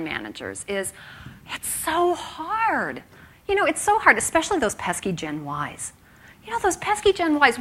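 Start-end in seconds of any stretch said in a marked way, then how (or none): 1.5-4.2 high, drawn-out exasperated whine; mock whining. Line managers complaining about staff retention, high mock whine. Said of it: "come up against when I start talking about employee retention". 6.5-7.6 "pesky" pitched high with a mock groan; mock exasperation. Line managers grumbling about young staff, mocking high whine. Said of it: "come up against when I start talking about employee retention".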